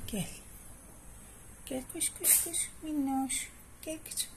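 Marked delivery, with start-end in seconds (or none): 1.6-4.3 high, soft coaxing call; calling a pet. Real, not acted. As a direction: coax the kitten to come. Kediyi çağırıyor, ince sesle. (ince, yumuşak sesle kediyi çağırma)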